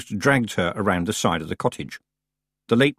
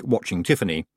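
ordinary delivery, nothing marked

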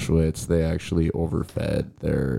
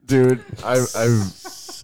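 high voice fighting back laughter; stifled giggles; 7 semitones higher, much slower than usual. Real, not acted. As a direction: hold in the giggles. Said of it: "He's trying not to laugh".

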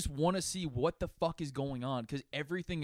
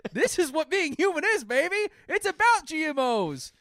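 mock preacher; 12 semitones higher, 6 dB louder than usual. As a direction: preach it like a zealot. Mock preaching about GMOs.

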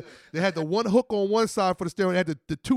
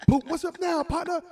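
higher, punchy challenge; mock taunt; 9 semitones higher than usual. Friend to the other driver after the hit, higher taunt. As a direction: throw the taunt right in his face.

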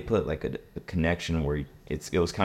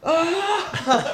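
high-pitched mock scream; comic shriek of panic; panicked scream; 3 dB louder than usual. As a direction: scream as if freaking out, hammed up. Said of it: "We're, like, freaking out".

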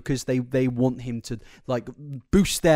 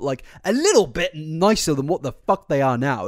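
ordinary talk, nothing marked

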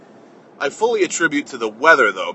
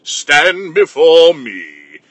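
put-on low, slow outraged old patriarch; Grandfather Cliff voice; 3 semitones lower, slower than usual. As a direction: thunder slowly like an offended grandfather. Grandfather character answers back.

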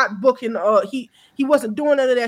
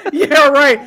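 louder, through laughter; laughing; 6 semitones higher, 10 dB louder than usual. Real, not acted. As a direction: say it while still cracking up. Laughing at friend's joke.

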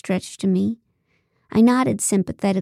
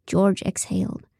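usual speaking voice

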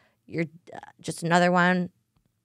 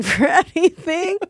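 laughing; 9 semitones higher, 5 dB louder, slower than usual. Real, not acted. Laughing at own joke.

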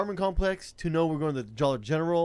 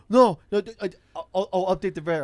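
high, stammering protest; imitated panicky rider; 5 semitones higher, 6 dB louder than usual. Rider promising driver a fare update, mock-panicky. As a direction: mimic a flustered passenger scrambling to agree.

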